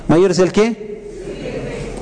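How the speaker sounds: a preacher slowly coaxing the congregation to fill in the gap; slow prompting. Shown as a demonstration. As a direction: draw out ¿Mayor es el qué? very slowly, rising at the end, so the crowd fills in the answer. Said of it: "mayor es el que".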